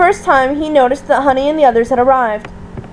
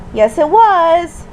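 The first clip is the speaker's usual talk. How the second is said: slow, higher sing-song lilt; smug sing-song contradiction; 4 semitones higher, much slower than usual. A narrator's reading. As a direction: draw it out in a teasing chant. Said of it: "replied in a sing-song voice".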